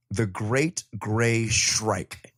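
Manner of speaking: slow, ominous unveiling; spooky slow name reveal; dramatic reveal. Pretend. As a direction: announce the bird like a horror title.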